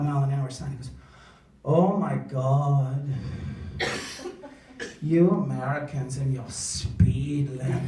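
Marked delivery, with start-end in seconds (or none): 1.6-7.8 low, slow disdainful voice; a hint of a snobby foreigner; lightly voiced scoffing in-law; mock sneering German. German brother-in-law mocked.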